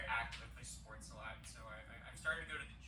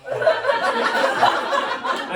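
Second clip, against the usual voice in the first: laughing; 16 dB louder, much slower than usual. Real, not acted. To the crowd, laughing through the admission. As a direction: crack up on "little".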